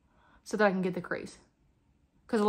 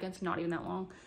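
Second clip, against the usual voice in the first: normal talking voice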